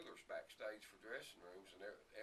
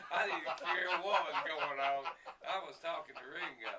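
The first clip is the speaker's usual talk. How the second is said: louder and slower, laughing; 7 semitones higher, 15 dB louder than usual. Real, not acted. Guest laughs about finally talking to Ringo. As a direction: savor the memory with a laugh.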